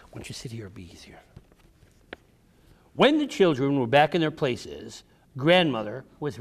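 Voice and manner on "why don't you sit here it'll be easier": soft aside. Real, not acted. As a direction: drop to a hush, just for one listener. Aside to a child.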